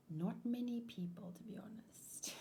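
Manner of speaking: unvoiced whisper, almost gone at the end; whispered aside. Real, not acted. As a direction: let the confession slip out in a whisper. Confiding aside to viewers.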